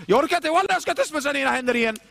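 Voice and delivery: a fed-up rant; fed-up believer. Acted. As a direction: throw up your hands, done with them.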